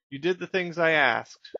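a mild questioning bear voice; voicing the bear.

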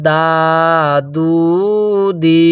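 দা, দু, দি each held long; long-vowel drill. Shown as a demonstration. Teacher models the three letters, drawn-out vowels. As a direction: separate syllables, each vowel stretched one alif. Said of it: "এক আলিফ টেনে পড়ব".

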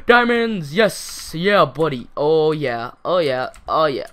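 loud, triumphant celebration; real excitement, shouted out; elated shout. Real, not acted. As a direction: cheer a lucky find at full voice. Celebrates finding diamonds.

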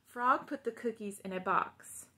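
ordinary delivery, nothing marked

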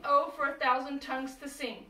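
ordinary delivery

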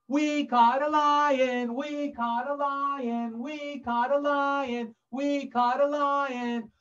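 high, slow, singsong chant; gloating hunters. Acted. Poachers celebrate their catch, high singsong gloating. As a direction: chant it in a drawn-out taunting singsong.

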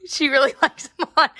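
laughing through the words; giggling while talking. Real, not acted. Amused by baby in mittens.

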